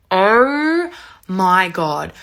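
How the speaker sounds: "Oh" stretched out very long; stunned emphasis. Retold or imitated. Storyteller recalling arms closing round something, drawn-out disbelief. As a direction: draw it out hugely before landing on God.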